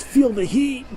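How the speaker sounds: high, hammed-up game announcer call; game announcer shout. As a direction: bark it out like a video game hype announcer. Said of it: "it just stops and it says".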